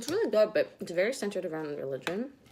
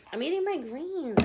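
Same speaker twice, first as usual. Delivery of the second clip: playful dog talk; 7 semitones higher, 4 dB louder than usual. Real, not acted. Telling the dog, laughing.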